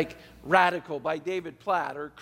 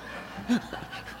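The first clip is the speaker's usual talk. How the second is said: soft breathy laugh; 8 dB quieter than usual. Real, not acted. Laughs at daughter's question.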